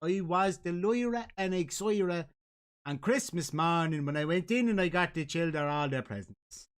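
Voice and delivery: put-on Dublin storytelling; Dublin accent. Shown as a demonstration. Host demonstrating slang for co-host, Dublin accent. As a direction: lay on the Dublin vowels. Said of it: "It's proper Dublin".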